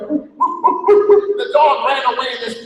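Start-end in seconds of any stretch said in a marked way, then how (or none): 0.1-1.4 rising yelping whoop; dog whoops. Acted. German Shepherd alarmed at the alien ship, yelping whoops. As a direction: bark it like an alarm. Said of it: "the German Shepherd went".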